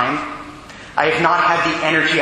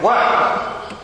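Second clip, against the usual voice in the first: irritated; 4 semitones higher than usual. Retold or imitated. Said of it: "And I was irritated".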